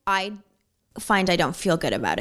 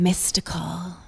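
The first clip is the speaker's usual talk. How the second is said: ASMR-style whisper; much slower than usual. Pretend. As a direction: breathe the word like ASMR. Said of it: "This is very A S M R".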